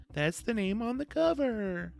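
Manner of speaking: high, slow, drawn-out teasing voice; milk the title joke in a silly high voice; cheesy mock-coy. Cheesy wink at the title drop.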